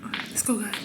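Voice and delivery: hushed urgent whisper; whisper, trying to stay hidden. Real, not acted. Hiding from Granny in game.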